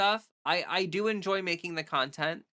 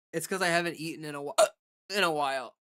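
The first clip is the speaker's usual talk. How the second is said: hiccups; faster than usual. Real, not acted. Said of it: "Oh no, I got the hiccups".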